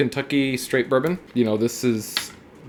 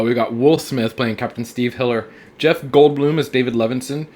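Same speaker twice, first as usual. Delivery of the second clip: usual speaking voice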